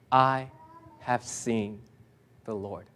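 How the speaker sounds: hushed reverence. Real, not acted. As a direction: drop the voice to reverent quiet. Quiet confession of faith.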